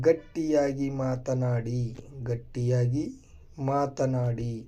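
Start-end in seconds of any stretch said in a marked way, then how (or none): none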